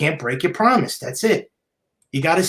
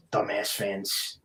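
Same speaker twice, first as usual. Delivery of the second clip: slow, much softer and lower muttered insult; under-breath aside; 7 semitones lower, 11 dB quieter, much slower than usual. Real, not acted. Vlogger muttering to himself about the fans, soft and slow. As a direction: drop the voice and mutter it slowly to yourself.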